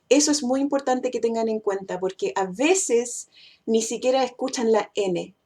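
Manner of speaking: normal talking voice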